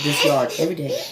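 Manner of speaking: fighting back tears; moved while recalling that morning; choked up. Real, not acted. Emotional retelling, voice cracks.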